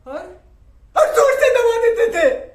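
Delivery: very loud, high frenzied cry; manic shout. Acted. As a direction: scream it as you press harder. Father reenacting smothering. (जुनून में ज़ोर से चीखना)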